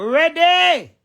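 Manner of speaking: loud call. Real, not acted. Calls out to the listener.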